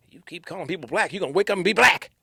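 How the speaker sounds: mom's warning, rising in pitch and volume; a hint of a mother's scolding; lightly voiced scolding mom. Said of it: "all moms probably say".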